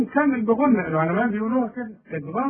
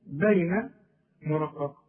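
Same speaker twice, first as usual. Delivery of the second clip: ordinary talk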